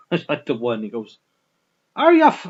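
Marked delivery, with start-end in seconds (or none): 2.0-2.5 high, loud stab at South African; attempted South African accent. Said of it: "I think it's a South African".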